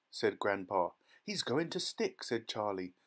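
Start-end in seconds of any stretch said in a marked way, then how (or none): 1.2-2.2 put-on high-pitched child voice; lightly voiced anxious lad; young boy observing. A narrator's reading. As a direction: predict trouble with concern. Boy watching the stuck child.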